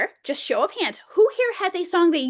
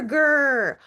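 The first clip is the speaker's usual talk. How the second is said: put-on playful louder growl for the kids; mock bear growl; 4 dB louder than usual. Shown as a demonstration. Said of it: "It's time to be a bear".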